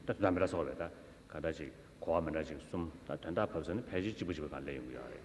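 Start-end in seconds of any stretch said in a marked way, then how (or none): none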